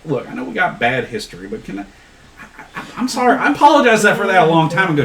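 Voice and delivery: high Appalachian twang, backpedalling; Chuck's Appalachian accent. Acted. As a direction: apologise in a thick Appalachian accent. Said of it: "you sound like fucking Chuck".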